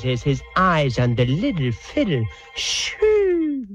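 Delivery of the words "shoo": very high, long sliding swoosh; vocal whoosh. Acted. Storyteller voices love at first sight, high whoosh. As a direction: let out a high comic shoo like a rocket. Love-struck trumpet's heart takes off.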